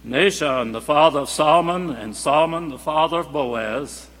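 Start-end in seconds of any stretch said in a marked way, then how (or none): none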